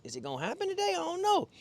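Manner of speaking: high-pitched mock fretting; retold worry, pitch climbing sharply at the end; stressed out. Angler retelling his worry over no bites, stressed out. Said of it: "I'm a little stressed out".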